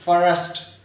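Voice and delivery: फॉरेस्ट with an added vowel, fa-rest; wrong two-syllable first. Shown as a demonstration. Teacher imitating learners' mistake in "first", split into two syllables. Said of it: "दो syllables में pronounce कर देते हैं".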